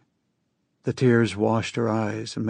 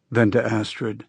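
usual speaking voice

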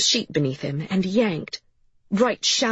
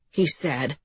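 ordinary delivery

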